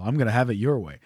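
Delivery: low, soft ad-read voice; commercial voice. Retold or imitated. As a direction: say it like a TV ad tagline. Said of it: "sounds like a commercial".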